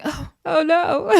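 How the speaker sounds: watching a friend hit the floor, drawn-out worried "oh no"; worried gasp. Retold or imitated. Narrator watching her favourite go down, slow alarmed gasp. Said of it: "I was like".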